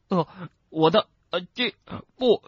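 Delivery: high, broken, choked protest; shouting through gag. Acted. Gagged boy objecting to his friend, muffled shouting. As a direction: force out words past a stuffed mouth. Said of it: "罗恩透过塞在嘴巴里的东西喊道".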